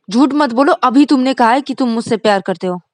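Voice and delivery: loud, high accusing; angry accusation. Acted. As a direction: call him a liar. Rani accuses Vikas. (गुस्से में इल्ज़ाम)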